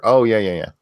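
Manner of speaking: ordinary talk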